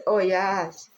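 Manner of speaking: dismissive people. Retold or imitated. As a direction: imitate people grumbling about another immigrant. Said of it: "people say".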